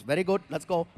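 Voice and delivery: Indian cab driver. Acted. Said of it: "he goes".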